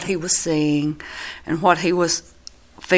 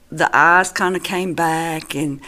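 ordinary delivery

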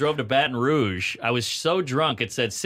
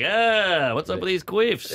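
goofy, pitched-up modern take on a Shakespeare line; Shakespeare in own cadence; 8 semitones higher, slower than usual. Retold or imitated. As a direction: deliver the classic scene in your usual slacker voice. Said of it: "Couldn't change".

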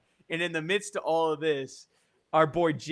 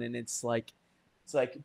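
ordinary talk, nothing marked

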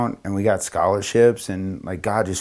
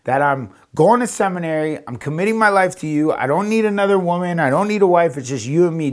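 high-pitched, insistent objection; retold indignant pleading; pleading protest; 7 semitones higher than usual. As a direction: argue back in exasperation, pitch raised. Acted protest to Jesus.

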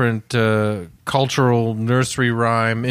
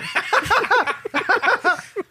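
high burst of laughter; laughing hard at himself; corny dad laugh. Real, not acted. Laughing at his own dad joke.

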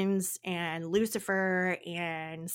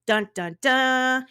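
dramatic dun dun dun; 4 semitones higher, 5 dB louder, slower than usual. Acted. Dramatic intro for Crowley.

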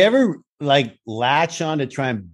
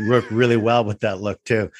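ordinary talk

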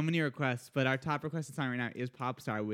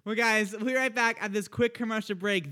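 higher, upbeat song intro delivery; radio announcer; 5 semitones higher than usual. Real, not acted. Host introducing the next song, radio announcer style. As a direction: throw to break like a radio host.

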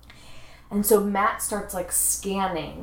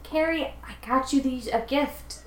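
put-on child's voice; 6 semitones higher than usual. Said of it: "he's like".

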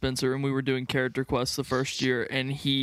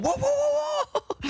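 much higher startled whoa. Retold or imitated. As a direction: jump at a sudden noise. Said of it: "and we're going".

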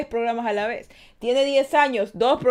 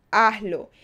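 ordinary talk, nothing marked

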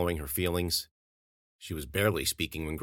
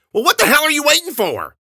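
put-on much higher and louder drunken scream; 14 dB louder than usual. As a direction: scream it at her across the room. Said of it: "he screamed".

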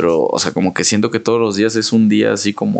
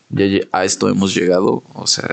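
normal talking voice

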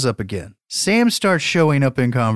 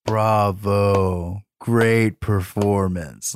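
slow, dry sarcastic bravo; sarcastic Sam; 5 semitones lower, much slower than usual. Retold or imitated. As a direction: drag out the mock applause. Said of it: "immediately Sam's like".

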